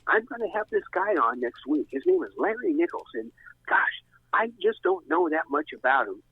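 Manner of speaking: imitated radio host, pitched well above own voice; Duffy. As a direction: do a high, hesitant on-air host impression. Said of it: "learned how to do a Duffy accent".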